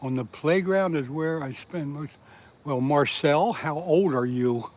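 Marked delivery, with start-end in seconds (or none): none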